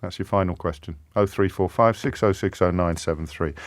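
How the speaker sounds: usual speaking voice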